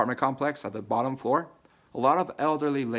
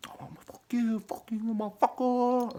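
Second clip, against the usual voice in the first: mock threat; 12 semitones higher than usual. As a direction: hiss it like a cartoon villain. Imagined angry reaction mocked.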